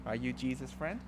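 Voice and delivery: ordinary delivery, nothing marked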